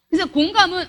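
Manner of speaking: ordinary delivery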